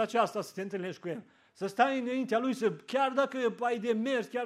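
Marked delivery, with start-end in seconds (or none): none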